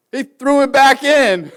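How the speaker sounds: high, loud and slow, still amazed; incredulous outburst. Real, not acted. Preacher recalling the guy tossing the bass back, high loud incredulous outburst. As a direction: let the disbelief burst out. Outrage at fish released.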